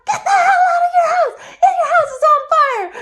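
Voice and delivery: high, loud frantic yell through laughter; shout. Retold or imitated. Kind friend yelling at a neighbour to flee, frantic shout. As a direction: scream at them to get out now. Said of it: "to shout".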